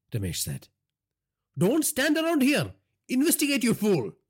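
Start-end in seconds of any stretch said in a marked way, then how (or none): 1.6-4.2 high, loud furious order; roaring. A narrator's reading. Boss roaring at the guard to investigate, high and loud. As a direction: roar it at the guard. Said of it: "Naresh roared".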